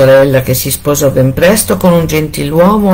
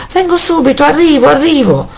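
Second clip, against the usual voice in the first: stalling wife; 11 semitones higher than usual. A narrator's reading. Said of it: "rispondeva la moglie".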